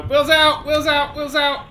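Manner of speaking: high, loud repeated commands; urgent shouts. Real, not acted. Player scrambling to drop the wheels, loud high shouts. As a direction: yell at the plane in a panic. Urgent landing gear panic.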